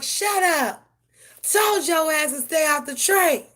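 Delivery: loud, high exasperated scolding; scolding shout. Retold or imitated. Owner scolding cat stuck in tree, loud high shout. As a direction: yell at the cat like a naughty kid.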